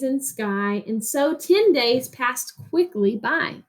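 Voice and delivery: put-on reading a picture book to children, rhythmic and lilting; expressive read-aloud narration; story reading. Reads story aloud to class.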